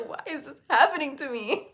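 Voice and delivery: wailing through laughter and tears; half sob, half laugh at your own misery; tearful laughing whine; laugh-crying. Retold or imitated. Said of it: "I just cried my eyes out".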